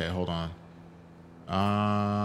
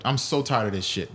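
usual speaking voice

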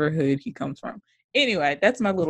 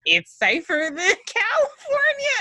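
very high, put-on voice with a laugh; high-pitched mimicry; 14 semitones higher than usual. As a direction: imitate him way up high, breaking into laughter. Mimics child's excited line, laughing.

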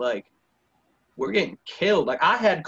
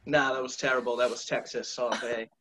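ordinary talk, nothing marked

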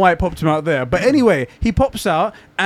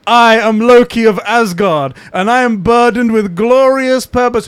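louder, slow, grandiose declaration; Loki, dark lord; 6 semitones higher, 6 dB louder than usual. Retold or imitated. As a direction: announce it like a pompous god-king. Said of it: "it was like".

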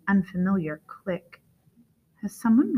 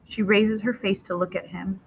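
plain reading voice

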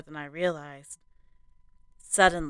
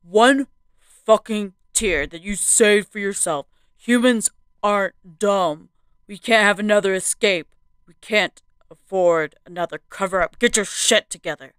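loud, harsh furious man; angry hiss through teeth; 3 semitones higher, 7 dB louder than usual. A narrator's reading. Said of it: "he hissed through his teeth".

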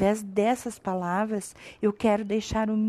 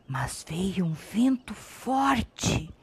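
whisper; 2 semitones lower, 8 dB quieter, much slower than usual. A narrator's reading. Narrating the strong wind.

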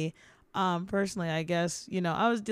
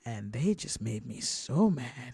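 low simmering anger; 8 semitones lower, 4 dB quieter, slower than usual. Retold or imitated. Remembering angry customer.

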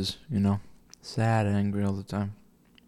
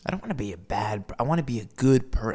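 ordinary delivery

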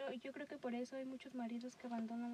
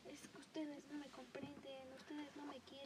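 mock dramatic mom; 10 dB quieter than usual. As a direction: play the martyr, half-whispered. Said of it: "la dramática".

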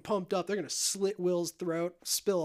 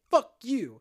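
louder, higher mock-angry comeback; retold indignant outburst; angry retort; 6 semitones higher, 6 dB louder than usual. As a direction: throw it back hard, play-acting. Acted quarrel over saving Will.

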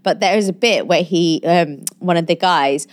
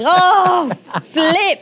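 high, drawn-out substitute swear; mock exasperation; 11 semitones higher than usual. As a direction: catch yourself with a kid-safe curse. Retold correcting own swearing.

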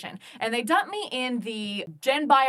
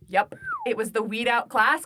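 ordinary talk

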